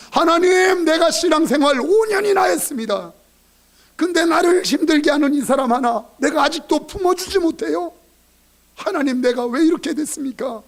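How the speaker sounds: a believer crying out in repentance, high and loud; impassioned repentant prayer. Retold or imitated. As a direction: confess before God with a rising plea. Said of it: "회개할 때".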